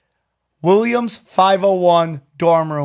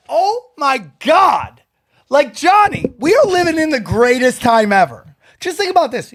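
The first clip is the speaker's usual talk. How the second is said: amazed; 7 semitones higher than usual. Retold or imitated. Host telling Johnny it's the greatest time ever, amazed. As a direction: marvel at it, voice soaring. Retold marvelling at sandcastle professionals.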